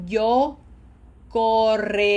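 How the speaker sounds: "yo correría" loud, with rolled RR and a long held E before the last R; lengthened E. Shown as a demonstration. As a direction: flow through "correría", stretching the E after the rolled R. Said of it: "Did you see how I lengthened that E".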